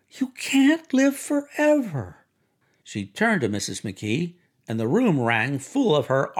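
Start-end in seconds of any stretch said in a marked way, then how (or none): 0.1-2.2 very high, slow, drawn-out exclamation; soaring woman's voice, lingering on each word; excited climax. Acted. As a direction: stretch it out high, utterly carried away. Myrtle's repeated excited thought climaxes.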